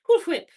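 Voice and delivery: Cool Whip high and loud; joking pronunciation. Shown as a demonstration. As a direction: pop out Cool Whip with a puffed WH. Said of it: "I feel embarrassed saying Cool Whip".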